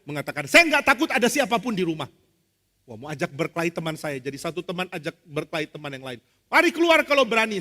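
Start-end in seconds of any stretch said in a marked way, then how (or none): 0.5-2.1 imitated yell, higher and louder; challenging shout. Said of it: "lalu dia teriak". 6.5-7.6 high, loud mock dare; challenging shout. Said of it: "lalu dia teriak".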